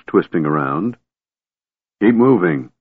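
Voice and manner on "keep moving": usual speaking voice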